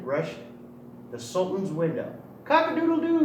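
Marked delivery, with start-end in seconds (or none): 2.4-3.2 high, loud crowing rooster call. Acted. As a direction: crow it out like a proud little rooster. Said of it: "he cried".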